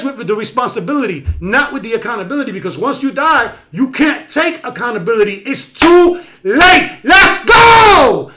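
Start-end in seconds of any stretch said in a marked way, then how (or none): 5.5-8.3 loud fired-up exclamation, higher and louder; pumped up, rallying the listeners; animated. Real, not acted. Host rallying his live stream audience, fired up and loud. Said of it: "Yes, I'm animated today".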